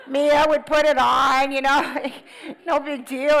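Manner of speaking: loud, slow, laughing admission; laughing confession. Real, not acted. Confessing to the audience how carelessly they used it, loud laughing confession. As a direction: laugh it off as no big deal.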